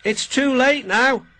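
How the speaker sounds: loud, high calling voice. A narrator's reading. Signalman says the repair must wait, calling out. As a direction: call the bad news down the line. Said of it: "called the signalman".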